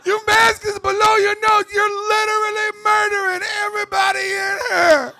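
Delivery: very high, loud, slow, squeaky whine; mock a panicky young store clerk policing masks; whiny Gen Z clerk. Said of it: "some little millennial or Gen Z".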